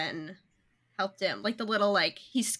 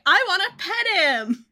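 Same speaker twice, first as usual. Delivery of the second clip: put-on higher, louder childlike plea; a small kid begging to pet the horse; eager little girl; 9 semitones higher, 7 dB louder than usual.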